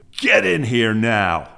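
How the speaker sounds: slow, loud barked order; colonel's shout. Retold or imitated. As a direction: boom out the command. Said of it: "That was the colonel yelling at him".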